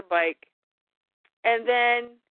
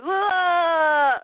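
high startled yelp, half-played; mock startled whoa; 6 semitones higher, 4 dB louder than usual. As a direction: react to being knocked over. Knocked for a loop.

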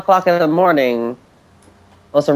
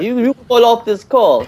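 put-on terrible Godfather accent; 7 semitones higher than usual. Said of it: "That was a terrible accent".

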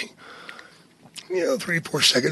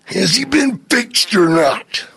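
slow, higher wrestler impression; Macho Man; 4 semitones higher, slower than usual. Macho questioning Jake about his snake, slow impression. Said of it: "You did a great Macho Man".

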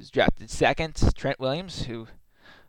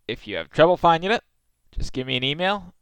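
ordinary talk

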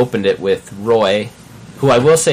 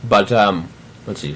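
talking with candy in mouth; chewing while talking; 5 dB louder, slower than usual. Real, not acted. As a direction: chew a Starburst while talking. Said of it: "Sorry, I'm chewing on a Starburst".

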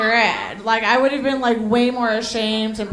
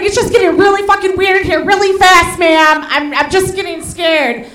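scared patient; 6 semitones higher, 5 dB louder than usual. Retold or imitated. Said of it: "And he was like".

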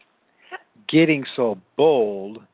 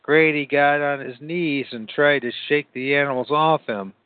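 plain reading voice

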